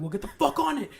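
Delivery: high, loud barked command; barking order. Retold or imitated. Fake captain chewing out the duty sergeant, barked order. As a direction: chew out the sergeant. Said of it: "And he's like".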